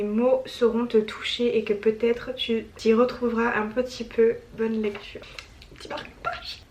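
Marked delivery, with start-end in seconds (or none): 5.8-6.6 barely voiced, very soft whisper; whispered aside. Real, not acted. Reader finding a gift bookmark, whispered aside. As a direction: murmur it under your breath.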